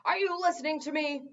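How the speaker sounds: loud, demanding yell; yelling gargoyle. A narrator's reading. Gargoyle yelling at the king, loud demand. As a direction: demand his attention loudly. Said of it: "the gargoyle yelled".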